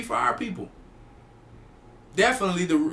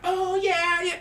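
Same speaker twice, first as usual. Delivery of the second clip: feminine voice; 12 semitones higher, slower than usual. Shown as a demonstration. Man imitating a sweet feminine answer, high-pitched. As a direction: squeak it up sweetly like a girly reply. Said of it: "Like that".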